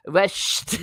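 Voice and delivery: Irish pronunciation of "West" as "Wesht"; Irish lilt on "West", "Wesht"; Irish accent. Retold or imitated. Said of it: "They say".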